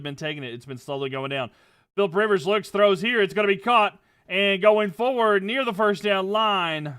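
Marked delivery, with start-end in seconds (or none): 2.0-6.9 loud, high play-by-play, building on each beat; excited play call. Acted. Calling the Colts drive for the chat, loud and excited. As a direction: sell the completion to the crowd.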